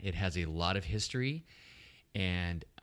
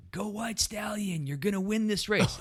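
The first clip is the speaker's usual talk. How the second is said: retold cheering yell; 12 semitones higher than usual. Spectator cheering on a rider at the start line, retold high cheering yell. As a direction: imitate a yell from the crowd, pitched way up. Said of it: "somebody yelled".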